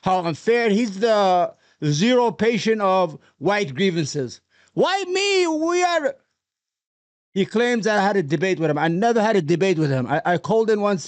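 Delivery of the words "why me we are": high-pitched mock self-pity; mocking whine. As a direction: imitate a complainer wailing in a much higher voice.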